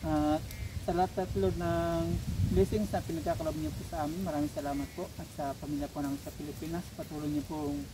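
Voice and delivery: prayer. Acted. Leads grace before eating.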